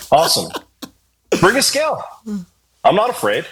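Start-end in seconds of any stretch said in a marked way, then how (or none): 1.4-2.0 much higher, squeaky-high demand; mock challenge. Singer repeating his weigh-in demand, high mock challenge. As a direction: pitch the demand way up for laughs.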